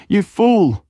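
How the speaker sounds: high woman's voice, shrieking; woman's scream. Acted. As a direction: shriek it at him in fury. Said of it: "a scream of a woman".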